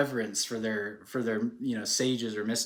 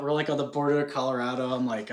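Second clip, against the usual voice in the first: ordinary delivery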